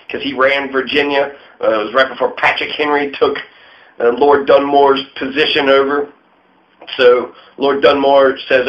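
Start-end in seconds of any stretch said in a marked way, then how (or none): none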